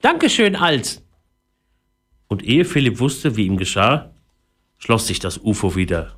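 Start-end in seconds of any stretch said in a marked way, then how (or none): 0.0-1.0 high squeaky parrot thanks. Acted. Parrot thanking the boy in a squeaky voice. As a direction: chirp a thank-you like a parrot.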